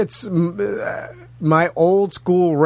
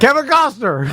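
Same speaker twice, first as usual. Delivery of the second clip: normal talking voice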